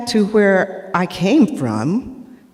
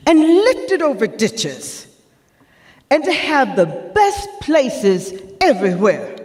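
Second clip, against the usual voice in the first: Sojourner Truth; 2 semitones higher than usual. Acted. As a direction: preach it to the crowd like an abolitionist. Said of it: "to portray Sojourner Truth".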